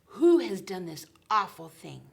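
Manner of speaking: loud demanding king's voice; king demanding to know who did it; angry king. Acted. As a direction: bellow it like an outraged king.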